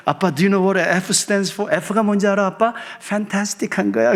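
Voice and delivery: playful daughter. Retold or imitated. Daughter joking with father.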